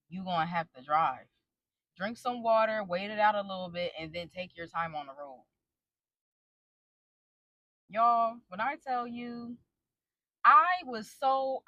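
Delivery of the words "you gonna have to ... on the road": low, soft imitation of his advice; gone quiet. Husband telling drunk wife to drive, quiet imitation. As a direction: mimic him, getting softer to the end. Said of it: "he gets quiet and he's like".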